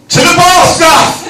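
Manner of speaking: loud, high toast with a laugh; shouted toast. Real, not acted. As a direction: call it out to the whole room. Calling out toast.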